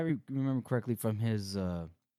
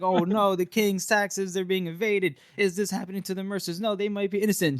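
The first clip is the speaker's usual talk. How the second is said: higher-pitched fretting onlookers; mock alarm; 8 semitones higher than usual. Onlookers fretting over evaded royal taxes, mock alarm. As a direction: play up the worried gossip. Mocking everyone's worry over scandal.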